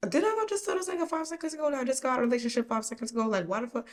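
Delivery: high, fast incredulous rant; exasperated disbelief. Retold or imitated. Incredulous reply to pushy customer.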